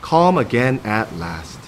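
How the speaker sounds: ordinary delivery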